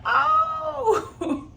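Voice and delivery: high, drawn-out "oh" of mock shock; shocked reaction. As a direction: react loudly to a scandalous comeback. Own reaction to harsh retort.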